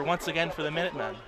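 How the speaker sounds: ordinary talk, nothing marked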